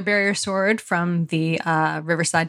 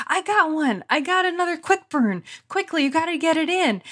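high, hurried mock-excited quote; mock urgent friend; 11 semitones higher than usual. Friend pushing the host to add a last-minute link, mock urgent. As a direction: imitate an eager friend pitched well up. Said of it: "Trike was like".

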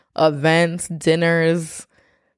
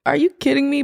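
incredulous; 9 semitones higher than usual. Retold or imitated. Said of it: "I'm like".

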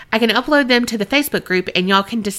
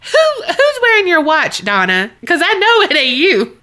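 mock watch; 7 semitones higher, 4 dB louder than usual. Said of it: "They would have been like".

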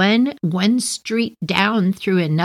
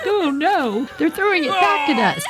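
mock crying; 9 semitones higher than usual. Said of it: "like, crying".

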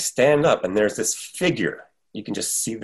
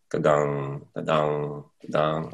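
bell imitation; 5 semitones lower, 4 dB quieter, slower than usual. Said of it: "just like".

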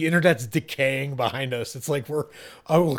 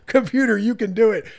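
higher, louder cheer-on; pleading encouragement; 7 semitones higher, 5 dB louder than usual. Acted. User rooting for a struggling computer, urgent encouragement. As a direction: urge it on in a raised voice. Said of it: "you're like".

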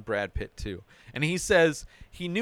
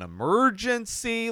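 "emergency" drawn out, pitched up; stress on emergency; 9 semitones higher than usual. Retold or imitated. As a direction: lean hard on "emergency", imitating him. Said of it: "really goes hard on the word emergency".

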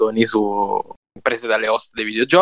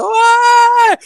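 high, loud joyful yell; excited shout; 8 dB louder than usual. Retold or imitated. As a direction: yell with delight. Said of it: "ho fatto".

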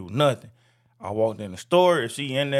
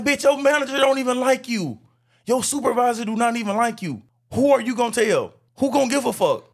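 angry retort; 9 semitones higher than usual. Retold or imitated. Arguing back at coworker.

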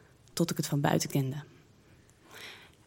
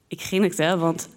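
usual speaking voice

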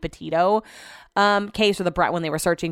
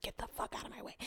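breathy voiceless whisper, much softer; 22 dB quieter, faster than usual. Retold or imitated. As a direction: hiss it under your breath at them. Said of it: "'cause I whispered".

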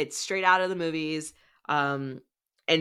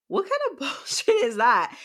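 much higher, laughing in disbelief; laughing outrage; 10 semitones higher, 3 dB louder than usual. Real, not acted. Host fuming at the book's twist, laughing disbelief. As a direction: laugh through the indignation.

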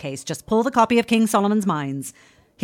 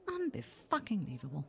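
much softer, lower grumble; disgusted mutter; 7 semitones lower, 18 dB quieter than usual. Acted. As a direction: spit it out under your breath. Grumbling about Holmes on holiday.

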